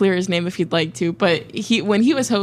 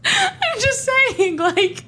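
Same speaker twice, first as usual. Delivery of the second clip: slow words through laughter; laughing; much slower than usual. Real, not acted. Host defending her point, breaking up laughing.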